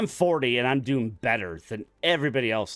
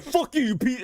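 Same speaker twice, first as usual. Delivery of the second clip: higher, half-hammed yell of the coworker; mock angry outburst; 12 semitones higher than usual. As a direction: throw a mock fit. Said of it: "He was just like".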